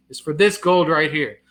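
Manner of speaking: ordinary delivery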